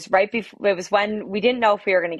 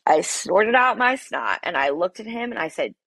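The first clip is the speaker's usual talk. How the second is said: ordinary talk, nothing marked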